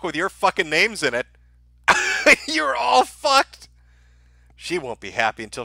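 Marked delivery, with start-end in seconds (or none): 1.9-2.4 a sudden, very high burst of laughter; gleeful high cackle. Real, not acted. Gloating over ominous death note. 2.5-3.7 drawn out very slowly and higher; slow, higher mock warning; mock doom. Mock doom to game devs.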